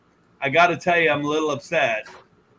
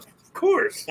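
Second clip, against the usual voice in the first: mock eager reply; 14 semitones higher, 4 dB quieter than usual. Acting his own eager reply.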